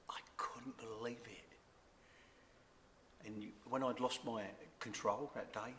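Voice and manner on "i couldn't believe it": hushed, barely voiced disbelief; a slow whisper of disbelief; stunned whisper. Real, not acted. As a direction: breathe it out in awe. Stunned by God's answer.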